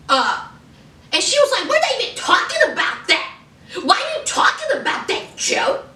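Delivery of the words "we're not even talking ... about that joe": high, rushed mock tirade; going off. Host as [name] going off at [name] over drugs, indignant. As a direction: fire indignant questions, then threats, pitched up. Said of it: "I mean, she went off".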